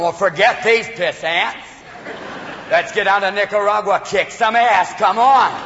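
cowboy film star rallying the troops; the Duke. Acted. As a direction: swagger it out, starting slow. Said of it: "he becomes the Duke".

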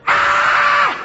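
long, high mock wail; rehearsed scream. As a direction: hold a practised fake scream. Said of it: "I could see them rehearsing emotions".

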